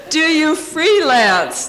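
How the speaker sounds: slow, laughing disbelief; incredulous laugh-drenched retelling; laughing through the quote. Retold or imitated. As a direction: quote it while cracking up. Retelling an insulting question, laughing.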